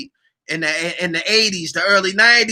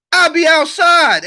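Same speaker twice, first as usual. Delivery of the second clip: higher, louder mocking quote; mock boast; 9 semitones higher, 7 dB louder than usual. Mocking what people claim, higher and louder. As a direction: toss it off like a cocky slogan.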